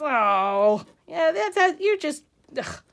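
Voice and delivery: loud, exasperated scoff; disgusted. Acted. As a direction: act out a friend dismissing it in disgust. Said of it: "He walks away disgusted".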